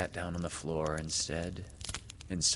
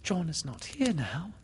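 breathy, high whispered gasp; anxious whispered gasp; gasped reply; 9 semitones higher than usual. Acted. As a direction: gasp it out under your breath. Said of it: "she gasped".

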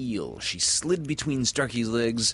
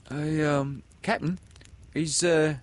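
high, hesitant stammering question; timid pirates; 9 semitones higher, 3 dB louder than usual. Acted. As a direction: afraid to bother the captain. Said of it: "they asked timidly".

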